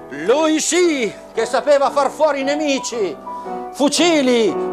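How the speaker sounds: usual speaking voice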